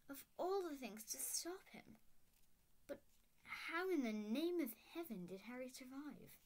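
stern witch stunned, voice up high; McGonagall astonished. A narrator's reading. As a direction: marvel at it, hushing mid-speech.

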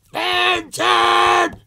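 Fenton! yelled very high and loud, held long; full-throated cartoon bellow; exaggerated shout. Vlogger shouts the name to match the shouting marks, loud high yell. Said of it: "exaggerated shouting marks".

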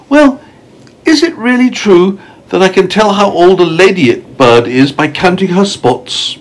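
put-on high-pitched, wondering storybook boy; polite, curious child character; curious young James. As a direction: ask in a high, eager boy's voice. Voices James questioning the ladybird.

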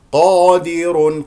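loud, slow recited قادر with a dark long qa; heavy Q. Shown as a demonstration. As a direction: stretch a full heavy قا in قادر. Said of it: "قاف مفتوح بعدها ألف". (قادر بقاف مفخمة)

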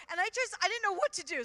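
usual speaking voice

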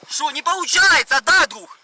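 loud, high, slow sarcastic taunt; angry taunt. Real, not acted. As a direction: throw it at him, loud and heated. Taunting officer forcing his leg.

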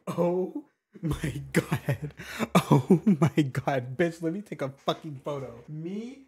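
slow, choked-up and overcome; real tearful disbelief. Real, not acted. Gamer taking a photo with the villager at last, crying. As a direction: say it softly through happy tears. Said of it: "I'm actually fucking crying".

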